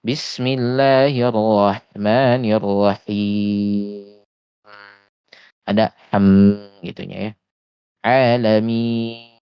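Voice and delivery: slow recitation, nasal ending held; recited with gunnah. Shown as a demonstration. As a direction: chant slowly, humming the final n. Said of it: "ujungnya itu ada gunnah".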